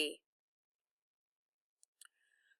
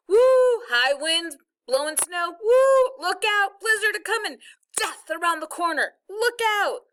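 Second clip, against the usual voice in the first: high, loud wailing like a storm wind; howling blizzard voice; 10 semitones higher, 7 dB louder than usual. Acted. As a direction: howl it like a spooky blizzard. Fake blizzard sound effects act.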